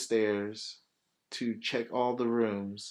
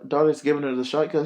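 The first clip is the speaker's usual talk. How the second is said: ordinary talk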